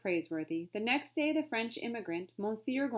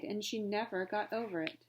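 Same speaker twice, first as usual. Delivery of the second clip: plain reading voice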